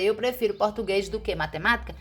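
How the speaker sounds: fast, offhand wrong sentence; wrong version. Shown as a demonstration. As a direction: toss off the mistake quickly. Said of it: "Em vez de você dizer".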